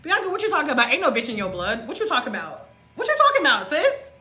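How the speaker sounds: gas yourself up like your own hype woman; hyping herself up. Retold or imitated. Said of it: "I be like".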